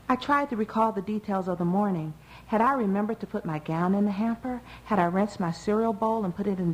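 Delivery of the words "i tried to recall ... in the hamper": ordinary delivery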